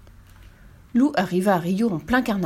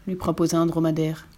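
ordinary delivery

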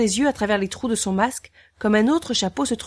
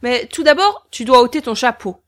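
higher, louder cheerful brute; jolly executioner; 4 semitones higher, 8 dB louder than usual. Acted. Executioner praising the boy, cheerful. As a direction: play him big and friendly.

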